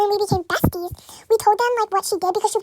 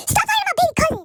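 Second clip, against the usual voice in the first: higher, louder retold yell; mock yell; 8 semitones higher, 5 dB louder than usual. Other cousin yells at Riley, higher and louder.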